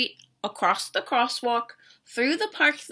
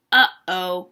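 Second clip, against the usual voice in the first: low, louder uh-oh; calling uh-oh; 8 semitones lower, 5 dB louder than usual. A narrator's reading. Bird calls out when the other bear vanishes, worried. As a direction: sound the alarm gently. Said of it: "calls Bird".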